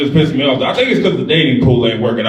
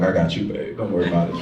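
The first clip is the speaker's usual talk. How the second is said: mock reassuring; 6 semitones lower, 8 dB quieter, faster than usual. Reassuring scared date.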